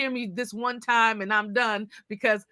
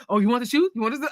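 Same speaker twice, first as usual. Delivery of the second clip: lightly voiced, louder shop clerk; pushy store clerk; 2 semitones higher, 5 dB louder, much faster than usual. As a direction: imitate an insistent salesperson. Acts out brisk shoe store clerk.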